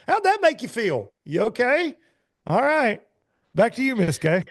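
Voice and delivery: very high, put-on interviewer voice; awkward interviewer. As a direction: mimic a host gingerly asking, then handing back. Mimics awkward interviewer after lost fish.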